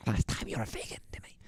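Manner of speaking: soft, breathless whispered taunt; mock whisper. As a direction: whisper it like a spooky secret. Voicing a menacing cop aside.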